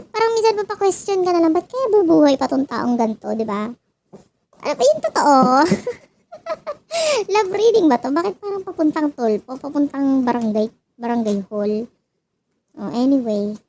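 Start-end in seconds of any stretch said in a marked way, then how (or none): 6.3-7.3 short high-pitched giggle. Real, not acted. Tarot reader giggles high at her own joke about the cards. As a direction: let out a squeaky giggle.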